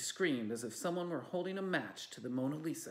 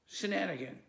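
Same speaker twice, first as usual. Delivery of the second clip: growl; 6 semitones higher, 3 dB louder than usual. A narrator's reading. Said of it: "growled Schadenfreude".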